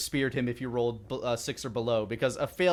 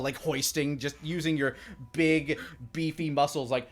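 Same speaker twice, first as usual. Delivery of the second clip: ordinary talk, nothing marked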